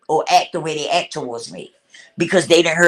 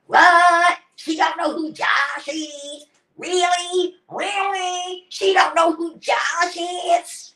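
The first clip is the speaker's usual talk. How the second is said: high, slow mock outrage; mocking the niece; 10 semitones higher, much slower than usual. Woman mimicking her niece's disbelief at the funeral, high and mocking. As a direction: imitate her scandalized disbelief. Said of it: "talking about".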